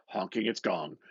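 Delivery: plain reading voice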